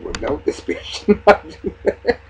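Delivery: laughing through the line in disbelief; real laughing outrage at the toast. Real, not acted. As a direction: laugh it out, can't believe it. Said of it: "I said".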